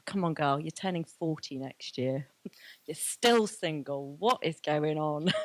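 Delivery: self-scolding. Retold or imitated. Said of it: "I was like".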